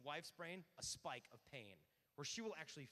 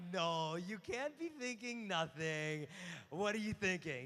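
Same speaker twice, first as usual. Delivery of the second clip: loud, drawn-out insistence; mock exasperation; 9 dB louder, slower than usual. Woman grilling her man on his thoughts, mock exasperation. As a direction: press him loudly, hammed-up disbelief.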